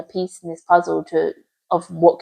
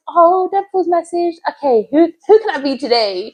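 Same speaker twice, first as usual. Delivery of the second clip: mock high voice; 9 semitones higher than usual. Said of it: "I'm like".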